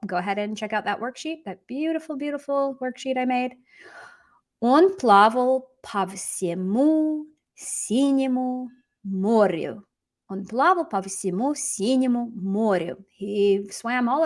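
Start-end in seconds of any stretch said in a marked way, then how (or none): 4.6-9.8 slow, word by word with gaps; slow model reading. Shown as a demonstration. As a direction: draw out each word for learners. Teacher models sentence slowly, then repeats.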